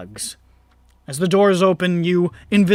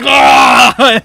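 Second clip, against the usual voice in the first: sudden loud startled cry, much higher; shocked yell; 7 semitones higher, 14 dB louder than usual. Retold or imitated. Orc suddenly visible, crying out in alarm. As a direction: burst out with a panicked shout on being seen. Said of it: "you're like".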